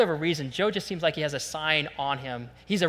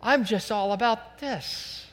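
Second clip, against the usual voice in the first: mock dreamy; 5 semitones higher, slower than usual. Preacher mimics a hearer who forgot the Word, mock dreamy. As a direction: swoon as if you forgot everything.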